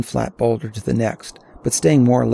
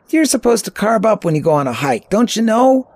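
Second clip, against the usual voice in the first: put-on high-pitched teen comeback, rising at the end; know-it-all teenager; 9 semitones higher, 4 dB louder than usual. A narrator's reading. Teen replying to older hiker's jibe, high know-it-all. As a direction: pitch it up, lecturing the elder. Teenage Bobby replies to teasing.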